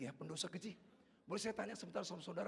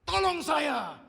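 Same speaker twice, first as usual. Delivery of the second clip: full-force high scream for papa; very loud child's cry for help; 9 semitones higher, 14 dB louder, much slower than usual. Retold or imitated. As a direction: shout desperately for rescue. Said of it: "tiba-tiba dia berteriak".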